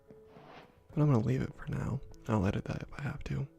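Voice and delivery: ordinary delivery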